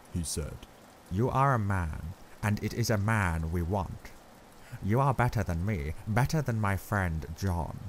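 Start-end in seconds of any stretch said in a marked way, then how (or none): 1.1-7.8 grave professor voice; measured and grave; grave Van Helsing. Acted.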